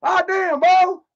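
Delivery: high, loud gruff holler; old female coach. Pretend. Doing Tina as an old coach, high hollering. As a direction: bark it like an old ball coach. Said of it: "old female baseball coach".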